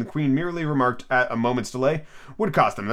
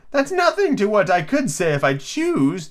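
high, slow, self-pleased Duchess; pleased storybook grande dame; 8 semitones higher, slower than usual. A narrator's reading. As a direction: boast in a high, drawn-out voice. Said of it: "replied in a pleased tone".

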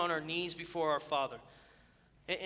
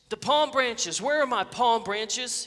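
higher, much louder outraged demand; indignant shout; 5 semitones higher, 8 dB louder, slower than usual. A narrator's reading. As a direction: shout it like a slighted celebrity. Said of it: "he shouted".

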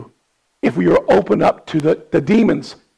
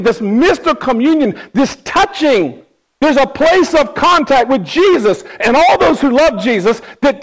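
fervent preaching; 6 semitones higher than usual. Acted. Pastor urges the congregation about communion, preaching hard. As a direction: preach it with full fire.

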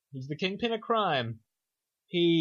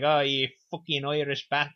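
broad put-on Irish brogue; mock Irish accent. Pub darts loser cursing the assassin, mock Irish accent. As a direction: curse in a stagey Irish accent. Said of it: "that's Irish accent".

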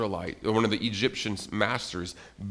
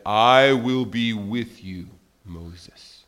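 reassuring God; 6 dB louder, much slower than usual. Acted.

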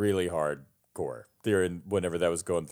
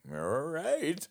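retold drawn-out reply; 9 semitones higher, 5 dB quieter than usual. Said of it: "It's like".

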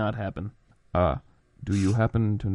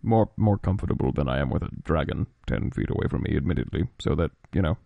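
Ali voice; 8 semitones lower than usual. Acted. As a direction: speak low and solemn, very measured. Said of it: "deuter-canonical Ali voice".

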